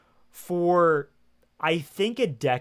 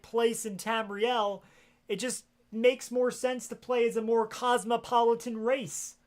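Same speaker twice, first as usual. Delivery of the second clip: higher, pleading mock apology to a scolding master; mock grovelling; 6 semitones higher than usual. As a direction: beg forgiveness with hammed-up panic. Grovelling apology to game character.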